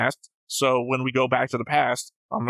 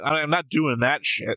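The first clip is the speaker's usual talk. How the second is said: ordinary talk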